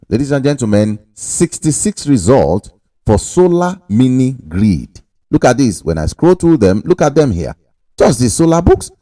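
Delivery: normal talking voice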